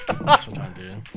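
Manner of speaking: high, loud fed-up declaration; exasperated outburst. Real, not acted. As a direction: announce it fed up. Exasperated at a miss.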